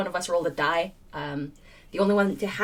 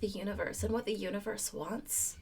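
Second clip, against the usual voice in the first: put-on Valley Girl hippie; 2 semitones higher than usual. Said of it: "with a touch of every single hippie girl".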